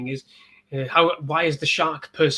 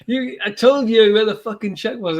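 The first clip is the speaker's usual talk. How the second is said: higher, louder mock rant; mock angry character; 7 semitones higher, 6 dB louder than usual. Exterminator blaming the hero, mock angry higher rant. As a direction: ham up the furious boss. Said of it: "when he was like".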